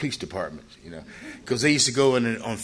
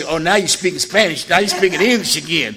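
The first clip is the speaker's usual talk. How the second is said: sarcastic retort; 5 semitones higher, 8 dB louder than usual. Retold or imitated. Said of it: "I wanted to say".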